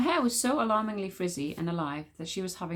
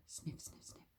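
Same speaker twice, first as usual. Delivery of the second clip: breathy sniff sniff sniffs; whispered sniffing; 22 dB quieter than usual. Acted. Witch smeller sniffs right behind Wish, breathy sniffs. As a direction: sniff like a dog, barely voiced.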